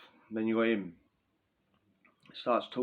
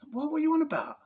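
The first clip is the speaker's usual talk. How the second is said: incredulous. Retold or imitated. Mocking reaction to the offer.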